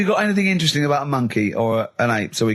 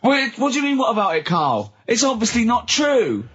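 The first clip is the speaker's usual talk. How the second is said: incredulous protest; 8 semitones higher than usual. Real, not acted. Protesting Carl's claim.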